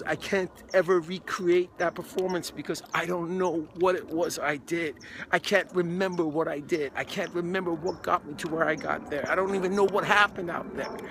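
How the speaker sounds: loud, high-pitched acted anguish; retold crying distress. Actor telling agent he can't recreate it, retold crying distress. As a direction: imitate a distraught, overwhelmed actor pleading. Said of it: "he's crying. He can't get the words out".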